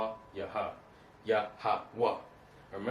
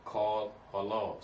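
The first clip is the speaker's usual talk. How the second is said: slow demo reading; slower than usual. Shown as a demonstration. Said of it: "Let's go to the fourth line".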